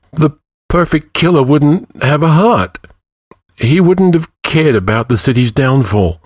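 high, trembling plea; quavering voice. A narrator's reading. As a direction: voice shaking as he pleads. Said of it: "Escavan said in a quavering voice".

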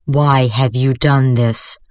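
slow, low horrified priest. Acted. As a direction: horror in the voice, slowly. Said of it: "Horror filled his voice".

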